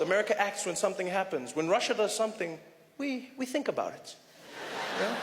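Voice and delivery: ordinary talk, nothing marked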